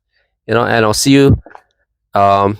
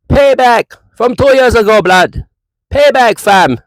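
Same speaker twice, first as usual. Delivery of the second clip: mock Jamaican accent; 8 semitones higher than usual. Vlogger gloating at a rival fan in a mock Jamaican accent. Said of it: "How's that Jamaican accent for me".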